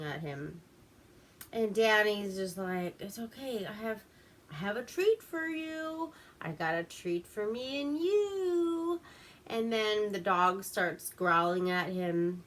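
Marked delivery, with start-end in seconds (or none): 3.0-9.0 soft voice climbing high; coaxing a dog. Retold or imitated. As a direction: sweet-talk a nervous dog, imitated. Danny placating a growling dog.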